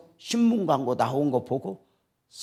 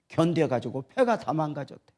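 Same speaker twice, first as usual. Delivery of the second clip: ordinary talk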